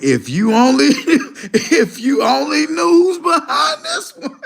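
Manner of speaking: high, slow, laughing taunt; laughing through it. Retold or imitated. Streamer replying to the chatter, laughing through the taunt. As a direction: taunt him while cracking up. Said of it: "And I'm all like".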